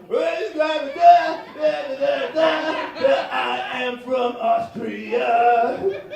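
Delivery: put-on loud, drawn-out da da in an Austrian accent; mock Austrian accent. Comic imitating an Austrian heckler in an Austrian accent. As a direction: stall then announce it in a thick Austrian accent. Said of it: "the other German's like".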